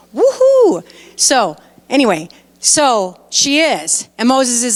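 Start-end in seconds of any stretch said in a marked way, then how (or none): 0.1-0.8 loud, high cheer of joy; excited whoop. Real, not acted. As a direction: let out a big happy whoop. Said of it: "I'm excited".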